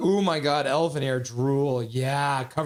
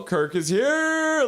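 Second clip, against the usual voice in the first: grand announcement; 10 semitones higher, 4 dB louder, much slower than usual. Pretend. Greeting a Star Trek chatter.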